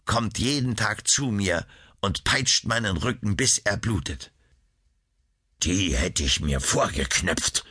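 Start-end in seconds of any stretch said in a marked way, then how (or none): 5.6-7.6 low, grumbled mutter; muttering king. A narrator's reading. King Schahriar grumbling about the sorceress, muttered low. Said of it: "murmelte König Schahriar".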